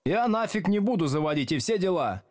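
a hint of a pirate-voiced troublemaker; hoarse pirate voice. A narrator's reading. Cheeky redhead refusing the teacher, a touch of pirate. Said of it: "с хриплым пиратским голосом".

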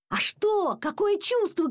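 high-pitched excited cry; mild shout. A narrator's reading. Dad quizzes his son about catching a flea, mild shout. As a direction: cry out the questions high, without much volume. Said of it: "кричал папа".

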